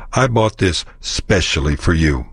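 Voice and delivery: "specially" said with no leading E; dropped E. Shown as a demonstration. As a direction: clip the start of "specially". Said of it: "toss out the E in especially".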